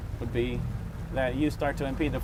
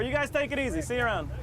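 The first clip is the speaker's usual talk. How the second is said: calling out; 12 semitones higher than usual. Real, not acted. Calling across to boaters.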